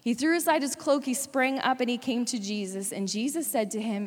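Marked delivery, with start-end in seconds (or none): none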